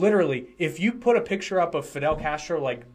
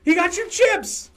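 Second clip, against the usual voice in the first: excited; 6 dB louder, slower than usual. Real, not acted. Excited over gift of chips.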